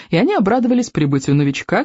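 plain reading voice

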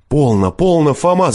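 high, urgent cry; calling out. A narrator's reading. Master intervening with the forester, crying out. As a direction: call out to stop him. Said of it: "закричал я".

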